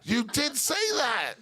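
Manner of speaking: high, slow, laughing insistence; gleeful calling out; laughing accusation. Real, not acted. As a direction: shout it through laughter, delighted. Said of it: "laughing accusation at the guest".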